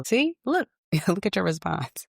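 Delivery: high, laughing told-you-so; a mom pointing out her point, chuckling; amused mother. Retold or imitated. Mother teasing daughter about her angry reaction. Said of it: "my mother is telling me".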